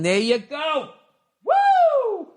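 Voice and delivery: high, loud whoop of joy; delighted celebratory whoop; cheer. Real, not acted. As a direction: cheer him on like a fan. Cheering listener's weight loss.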